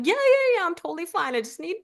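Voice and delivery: high, bright insistence that everything is fine; chirpy I'm-fine voice covering distress; forced reassurance. Retold or imitated. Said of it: "And I'm like".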